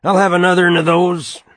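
put-on loud, high drunk demand; whiskey voice rising. A narrator's reading. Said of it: "Shortly the whiskey voice rose".

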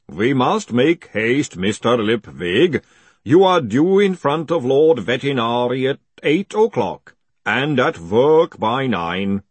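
slow, booming pronouncement; booming golem. A narrator's reading. Golem hurrying its captive to Lord Vetinari, booming. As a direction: boom out the schedule, slow and stately.

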